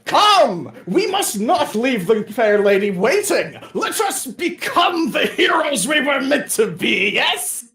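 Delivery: loud, high, theatrical knightly speech; rally the party like a storybook knight; grand knight. Acted. Quixote rallying the party, loud theatrical knight.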